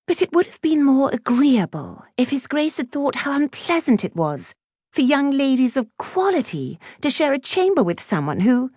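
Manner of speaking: high, prim disapproval; haughty schoolgirl, pitched well up; snobbish Clementine. A narrator's reading. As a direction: deliver the insult in a lofty high voice. Voices snobbish Clementine insulting Betsy.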